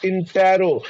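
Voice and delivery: intero said slowly as "in-TE-ro"; correct pronunciation. Shown as a demonstration. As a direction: give intero a clear "te" before the "ro". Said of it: "T E double R O होता है".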